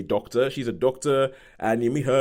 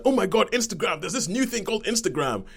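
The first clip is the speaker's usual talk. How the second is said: excited; 9 semitones higher than usual. Retold or imitated. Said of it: "everybody's like".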